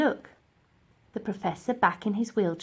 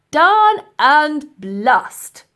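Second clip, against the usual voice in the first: very slow, much louder furious oath; thundered; 7 semitones higher, 14 dB louder, much slower than usual. A narrator's reading. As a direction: roar in defeat. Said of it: "he thundered".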